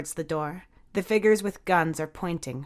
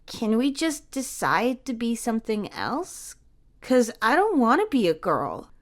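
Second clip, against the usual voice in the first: put-on higher child's plea; a boy thinking aloud; little boy voice; 5 semitones higher than usual. A narrator's reading. Jamie deciding what to be, small and earnest.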